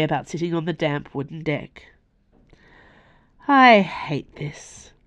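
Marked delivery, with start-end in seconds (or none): 3.5-4.9 frustrated. Acted. Nimue frustrated, slow and strained.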